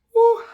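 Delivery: sharp high exhale fighting back tears; emotional whew. Real, not acted. Steadying herself talking about her grandpa, emotional whew. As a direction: let out a loud shaky whew as tears rise. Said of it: "I wasn't gonna get emotional".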